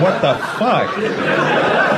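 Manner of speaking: high, drawn-out disbelief with a laugh; stunned what the fuck. Retold or imitated. Comic retelling backstage of shock at the insults, high and drawn out. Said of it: "And I'm like".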